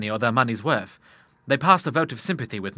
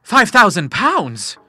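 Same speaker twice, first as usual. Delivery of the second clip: slow, high and loud amazement; astonished gasp; 8 semitones higher, 9 dB louder, much slower than usual. A narrator's reading. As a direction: stretch out the sum in disbelief. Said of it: "he gasped".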